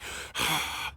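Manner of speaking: a long, breathless imitated huff; mock huffing sigh. Mechanic imitating the customer's impatient huffing, mock sigh. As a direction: puff out air like an annoyed customer. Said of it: "like, huffing and puffing".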